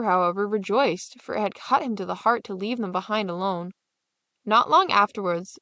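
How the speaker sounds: ordinary delivery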